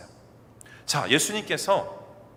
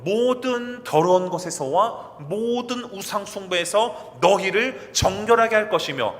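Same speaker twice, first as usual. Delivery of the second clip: emphatic scripture reading; 5 semitones higher, 6 dB louder, slower than usual. Real, not acted.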